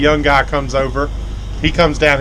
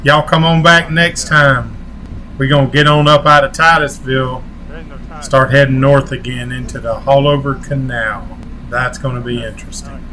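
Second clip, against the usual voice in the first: outro sign-off; 4 dB louder than usual. Acted. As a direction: sign off to viewers like a channel host.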